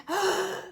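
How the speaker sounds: quick sharp gasp up high; snatch a shallow breath; sharp shallow intake of breath. Shown as a demonstration. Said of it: "if you do that, that's a very shallow breath".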